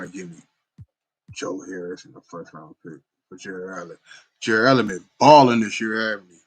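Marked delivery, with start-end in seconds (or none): 4.4-6.4 louder, hyped praise for the player. Real, not acted. Gamer hyping a player to viewers, loud and excited. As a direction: sell how good he's been.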